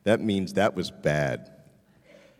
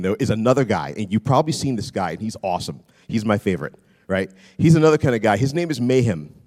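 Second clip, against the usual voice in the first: normal talking voice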